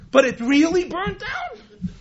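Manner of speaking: high, slow, rising question; acted disbelief. Miser turns again to his manager, high-pitched disbelief. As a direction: stretch it out in mock incredulity. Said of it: "he still can't believe it".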